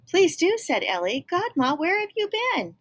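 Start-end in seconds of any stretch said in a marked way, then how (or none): 0.1-0.6 high, loud eager welcome; girl thrilled at the door. Acted. Ellie eager. 1.3-2.8 high, eager question; girl glad to see Godma. Acted. Ellie calls godmother.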